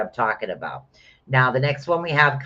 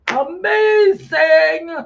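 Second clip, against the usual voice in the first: high, loud, drawn-out rave; slow build to a soaring cry; excited exclamation; 8 dB louder, much slower than usual. Real, not acted. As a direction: sing out the last word way up high. Exclaiming over the card reading.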